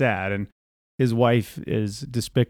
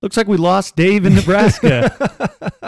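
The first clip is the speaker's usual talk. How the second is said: slow, higher, louder mock host voice; hammed-up slow radio host, louder; 9 semitones higher, 8 dB louder, slower than usual. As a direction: wrap up the dropped call like a talk-radio host. Mock host drops the call.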